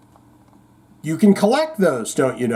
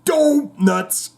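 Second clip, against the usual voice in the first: high exclaimed catchphrase with a hint of cartoon; lightly voiced cartoon dad's yelp; Homer Simpson; 9 semitones higher than usual. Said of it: "What did Homer Simpson say".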